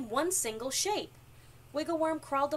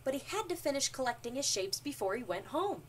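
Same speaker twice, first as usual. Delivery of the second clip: ordinary delivery, nothing marked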